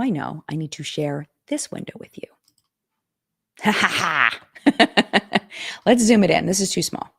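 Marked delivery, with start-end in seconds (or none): 3.6-5.4 slow ha-ha-ha cackle; loose, drawn-out cackle; drawn-out laugh. Real, not acted. Laughs while fumbling with screen sharing.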